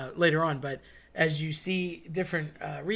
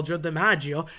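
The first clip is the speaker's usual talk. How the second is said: ordinary talk, nothing marked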